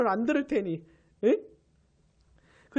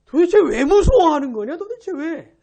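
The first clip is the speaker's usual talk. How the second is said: loud, exasperated rebuke; scolding; 4 semitones higher, 9 dB louder than usual. Acted. Jesus scolding his disciples for being afraid, sternly. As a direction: demand an explanation, acting out a stern telling-off. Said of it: "왜 무서워하냐고 혼내시는".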